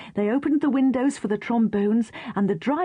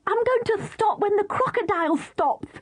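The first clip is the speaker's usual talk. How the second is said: shouting; 11 semitones higher than usual. Acted. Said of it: "shouted Katy".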